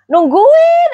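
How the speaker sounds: high squeal of delight; playful squeal. Real, not acted. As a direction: squeal it at the fans. Teasing fans who wait.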